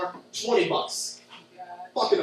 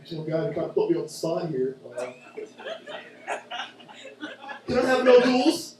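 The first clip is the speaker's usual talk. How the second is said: fumbling, slow customer; hesitant customer; 3 semitones lower than usual. Retold or imitated. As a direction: mumble the order under pressure. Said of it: "customer ordering".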